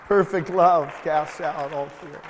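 loud, rising preaching proclamation; preacher declaring the verse at full force; proclaimed scripture. Acted. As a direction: ring it out over the congregation.

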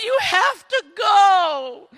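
loud, high, drawn-out plea; anguished little girl. Acted. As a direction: push it away with a hammed-up cry. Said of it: "she pushes the horse away".

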